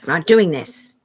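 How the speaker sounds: loud and final on doing; firm loud refusal. Retold or imitated. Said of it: "that was me saying".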